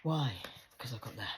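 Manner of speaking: ordinary talk, nothing marked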